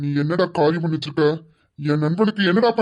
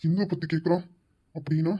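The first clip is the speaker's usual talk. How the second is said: softer, low urgent hush; worried hushed aside; 3 semitones lower, 8 dB quieter than usual. Acted. Husband tells wife the secret is out, hushed. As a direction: keep it down so nobody overhears.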